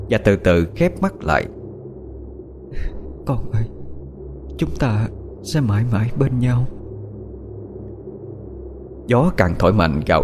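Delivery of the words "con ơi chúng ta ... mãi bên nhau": put-on faint, higher-pitched words to her baby; a tender promise to her dying child; dying mother's farewell. (giọng người mẹ thều thào với con)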